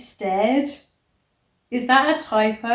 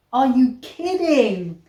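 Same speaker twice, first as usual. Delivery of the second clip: louder, slowed disbelief; exasperated; 4 dB louder than usual. Real, not acted. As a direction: drag it out in exasperation. Reacting to absurd book.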